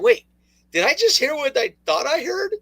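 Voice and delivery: high-pitched mock double take; incredulous. Host replaying his reaction to the broadcast, mock incredulous. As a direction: act out stopping short in disbelief. Acts out own disbelief while watching.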